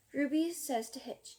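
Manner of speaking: ordinary delivery, nothing marked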